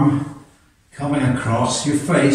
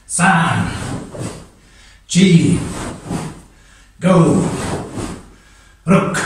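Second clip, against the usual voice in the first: sharp martial arts commands; 6 semitones higher, much slower than usual. Real, not acted. Karate count in class.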